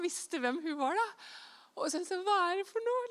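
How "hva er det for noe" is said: indignant disbelief. Retold or imitated. Retelling an indignant reaction.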